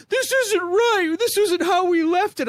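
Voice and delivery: high, slow whiny mock sob; mock crying. Recruits crying over their wrecked barracks, high mock whine. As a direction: whimper it like a recruit about to cry. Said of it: "people crying like".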